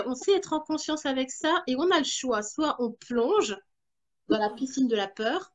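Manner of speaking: ordinary talk, nothing marked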